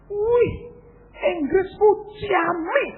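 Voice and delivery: high, loud, drawn-out exclamation; imitated admiring yell. Retreat listener praising his English, imitated high yell. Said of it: "Terus dia teriak".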